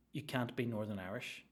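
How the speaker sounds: normal talking voice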